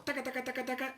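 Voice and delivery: high, rapid taca-taca heel noise; staccato mimicked footsteps; clacking heels. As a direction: imitate heels drawing nearer. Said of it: "sentía los tacos".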